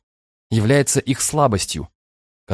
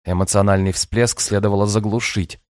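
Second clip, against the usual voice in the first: usual speaking voice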